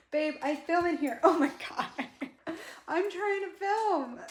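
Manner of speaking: high, laughing protest to the dog. Real, not acted. Pleading with her husky Pearl to give up the beanbag, laughing high.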